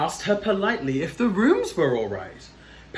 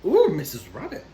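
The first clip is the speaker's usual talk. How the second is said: ordinary delivery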